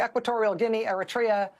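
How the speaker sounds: normal talking voice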